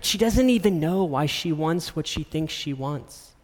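ordinary delivery

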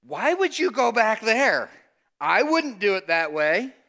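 high, loud incredulous protest; disciples protesting. Retold or imitated. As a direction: push back in disbelief. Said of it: "The disciples look at him and say".

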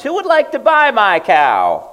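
high, loud market call. Acted. Old man calling to farmers to sell the cow, loud call. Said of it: "called out to the farmers".

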